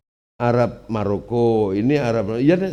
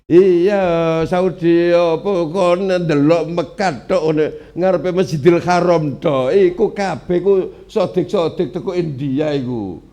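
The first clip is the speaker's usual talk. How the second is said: heated loud arguing; 5 semitones higher, 7 dB louder than usual. Real, not acted. Heated argument about Saudi.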